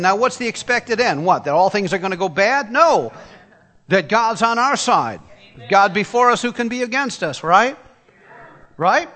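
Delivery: high, loud preacher's call to the crowd; full-force preaching with call and response; fervent preaching. Real, not acted. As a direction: drive it home to the congregation.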